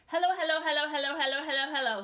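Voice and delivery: rapid loud hello barrage; fast taunting. A narrator's reading. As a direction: rattle them off fast. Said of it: "as fast as she could".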